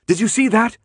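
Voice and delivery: high, loud and urgent; a high startled call to her friends; alarmed. Acted. Brenda alarmed after shouting.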